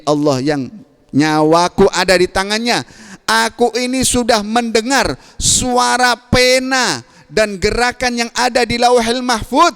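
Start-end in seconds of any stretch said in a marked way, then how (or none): 1.9-9.7 fervent preaching. Acted. Fervent preaching, high and slow.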